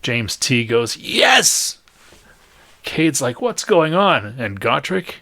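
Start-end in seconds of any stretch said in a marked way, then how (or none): 1.0-1.8 very high, loud triumphant cheer; exultant shout. Acted. Game master voices a teammate cheering, exultant shout. 3.4-4.3 high, loud alarmed question; bewildered shout. Acted. Game master voices a confused teammate, calling out.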